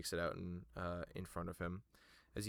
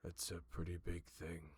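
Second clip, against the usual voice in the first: softer, grave herald; quiet and solemn, a touch lower; the Herald's voice; 3 semitones lower, 7 dB quieter than usual. Acted.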